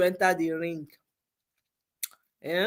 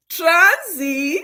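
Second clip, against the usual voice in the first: "Transi" said the Nigerian way, high and bright; Nigerian accent; 8 semitones higher, 5 dB louder than usual. Shown as a demonstration. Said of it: "Na in Nigerian accent".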